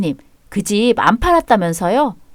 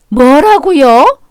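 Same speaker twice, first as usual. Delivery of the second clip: slow, stretched, louder what; outraged disbelief; 4 semitones higher, 5 dB louder, much slower than usual. Acted. Mother stunned by the father's insult, slow outraged question. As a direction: drag it out in shocked anger. Mother hears she must be silent.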